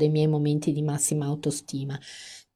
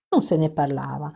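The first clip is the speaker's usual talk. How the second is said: plain reading voice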